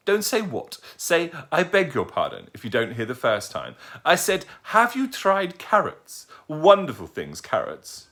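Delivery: put-on high, prim old lady correcting manners; a fussy grandmother scolding gently. Old lady correcting the owl's manners, high and prim.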